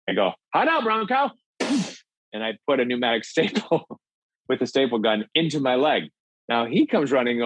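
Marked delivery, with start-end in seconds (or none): 0.5-1.3 high mock bravado. Bravado before stapling leg.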